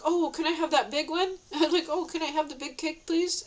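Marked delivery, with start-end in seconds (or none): 0.0-1.4 higher-pitched, cheerful polite request; happy, nice asking. Retold or imitated. Asking for a slice at the cake table, happy and nice. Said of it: "And I'm, like, happy". 1.9-3.4 high, eager little request; happy, nice asking. Retold or imitated. Asking servers for the big cake, happy and polite. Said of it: "And I'm, like, happy".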